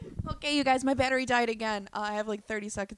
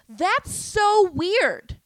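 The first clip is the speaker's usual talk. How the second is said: much higher, louder, very slow "That's so weird"; incredulous drawl; 9 semitones higher, 11 dB louder, much slower than usual. Retold or imitated. Telling the comic his remark is weird, a high, slow, exaggerated drawl. Reacting to the comic's creepy line.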